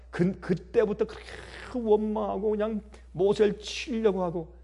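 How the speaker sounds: usual speaking voice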